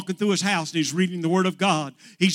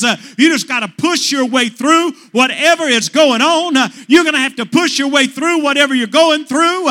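rising, driving preacher's cadence; full-force preaching; 6 semitones higher, 8 dB louder than usual. Acted. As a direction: push the congregation forward. Preaching builds.

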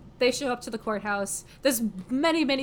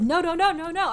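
mock panicked higher, louder no's; frantic refusal; 9 semitones higher, 5 dB louder than usual. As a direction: protest in a loud, high rush of no's. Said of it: "And he's like".

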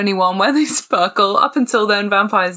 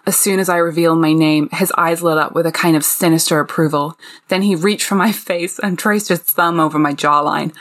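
put-on American accent; 2 semitones lower than usual. Reading a vampire novel excerpt to co-hosts, American accent. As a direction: read it in your best put-on American. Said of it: "pull in my best American accent".

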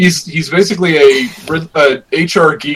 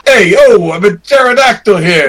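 mock Brooklyn accent; 3 semitones higher than usual. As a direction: play a pterodactyl from Brooklyn. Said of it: "No, no, no, that's Brooklyn".

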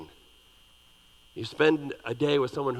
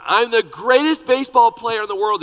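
put-on loud, higher, bragging little boy; boastful little boy; 7 semitones higher, 9 dB louder than usual. Little boy with a bat brags to himself, loud. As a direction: shout it out like a cocky kid. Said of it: "he said".

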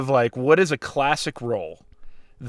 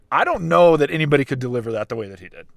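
usual speaking voice

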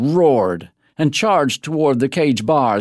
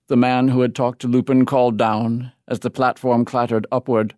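ordinary delivery, nothing marked

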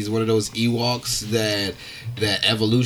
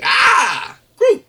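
mock shout; 12 semitones higher, 5 dB louder than usual. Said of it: "He's like".

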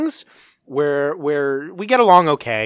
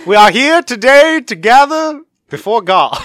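preacher voice; 8 semitones higher, 9 dB louder, slower than usual. Pretend. Mock wedding officiant.

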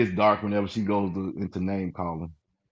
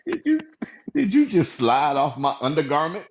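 put-on much higher, shocked southern belle accent; shocked southern belle, slowing down; 6 semitones higher than usual. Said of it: "Southern belle".

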